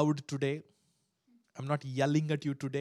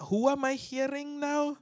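confused angels; 12 semitones higher, 3 dB louder than usual. Acted.

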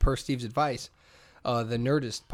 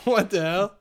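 mock British accent; 6 semitones higher, 4 dB louder, slower than usual. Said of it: "And I'm just like".

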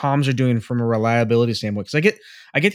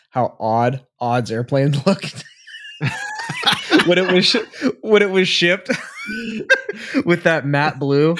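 laughing; 5 semitones higher than usual. Real, not acted. Laughing fit over Odd's airplane.

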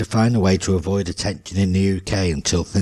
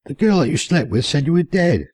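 higher, shaken explaining; shocked; 8 semitones higher than usual. Acted. Charles telling Wayne the girl's story, shocked. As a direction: blurt it still in shock. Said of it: "said a shocked Charles".

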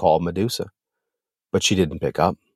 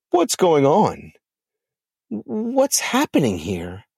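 shocked; 7 semitones higher, slower than usual. Acted. Lilith in shock.

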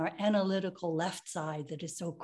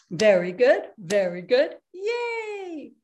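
cheering; 3 semitones higher, 6 dB louder, slower than usual. Shown as a demonstration. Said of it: "cheer after exercise".

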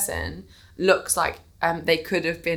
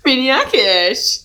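loud playful; 5 semitones higher, 8 dB louder, slower than usual. Acted. Playing with the word loudly.

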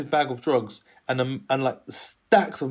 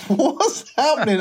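high, slow and laughing; bewildered laugh; 12 semitones higher, 4 dB louder, slower than usual. Retold or imitated. Alarmed at the approaching ringleader, a slow high laughing question. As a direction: let the panic leak out as a giggle.

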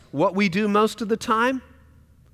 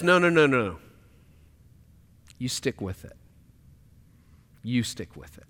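gentle urging; 8 semitones lower, 7 dB quieter than usual. Acted. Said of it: "And love says".

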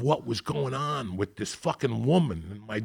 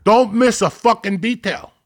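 shouted demand; 12 semitones higher, 12 dB louder than usual. Retold or imitated. Italian mentor's advice quoted.

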